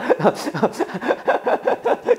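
high, wheezy burst of laughter; laughing. Real, not acted. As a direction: crack up at your own joke. Amused at his own joke. (높은 소리로 터진 웃음)

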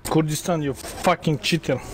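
ordinary talk, nothing marked

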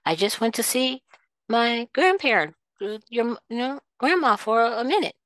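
mock careless mother. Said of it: "It's like".

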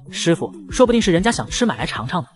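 usual speaking voice

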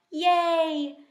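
put-on a kid thrilled about pancakes; excited cheer.

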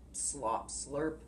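low slurping tiger. Acted. Tiger licks his teeth at the trapped animals, low slurp. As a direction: lick your lips like a hungry tiger.

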